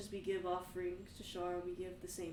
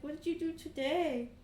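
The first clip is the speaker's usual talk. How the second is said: put-on high eager kid voice; a boy asking his dad brightly; young Kane; 9 semitones higher, 8 dB louder than usual. Young son asks his dad about his day, high and eager.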